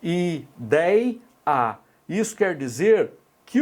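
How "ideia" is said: i-dei-a split into syllables. Shown as a demonstration. Teacher separating ideia for the class. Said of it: "Veja só, olha".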